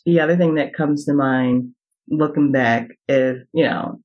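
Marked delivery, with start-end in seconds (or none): none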